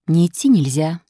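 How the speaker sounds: usual speaking voice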